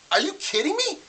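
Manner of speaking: high-pitched retold disbelief; incredulous. Retold disbelief at the phone company.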